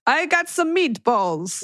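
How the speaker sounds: high, drawn-out Italian mom caricature. Acted. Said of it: "is it like your mom's like".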